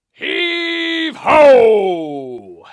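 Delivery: high, loud, long pirate shout; hauling call. Pretend. Pirate calling crew to pull the ropes, long shouted chant.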